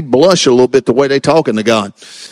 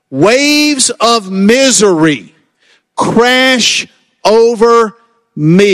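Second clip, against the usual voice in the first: slow, weighty reading of a lament; dramatic scripture reading; 3 semitones higher, slower than usual. Acted. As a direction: let each phrase of misery sink in slowly.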